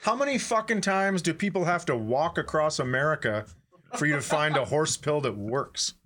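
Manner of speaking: usual speaking voice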